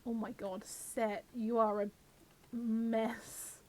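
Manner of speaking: ordinary talk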